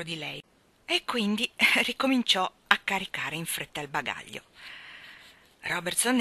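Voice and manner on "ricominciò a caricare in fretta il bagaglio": ordinary delivery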